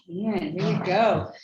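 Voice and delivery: ordinary talk, nothing marked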